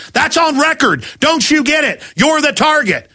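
high-pitched, emphatic ranting; heated rant. Real, not acted. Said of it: "All right, I'm ranting".

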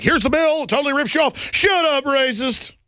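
high-pitched retold exchange; hammed-up quoted squabble; mock argument. Acted quarrel over a bill.

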